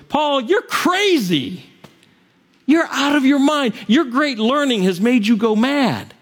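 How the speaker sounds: high-pitched acted yell; loud outcry. Festus yelling at Paul during his defense, loud outcry. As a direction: cry out in disbelief, pitched well up. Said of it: "says in a loud voice".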